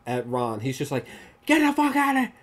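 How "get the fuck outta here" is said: high-pitched mock shout; imitated yell. Movie cop yelling at Ron in the street, imitated shout. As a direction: act out a frantic yell at someone fleeing. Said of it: "Adam Driver literally yells".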